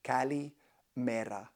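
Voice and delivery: slow, careful Kalimera, stress on li; slow model pronunciation of Kalimera; clear kalimera. Shown as a demonstration. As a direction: spell Kalimera out slowly, ka-li-ME-ra. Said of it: "it's so simple".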